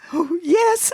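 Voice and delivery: put-on high, eager little-girl voice; girlish excitement. A narrator's reading. Girl answers her new friend about the bookmobile, excited. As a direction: gush it with delight.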